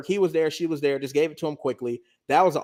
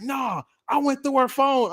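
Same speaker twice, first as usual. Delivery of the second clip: mock shout; 7 semitones higher, slower than usual. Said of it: "He like".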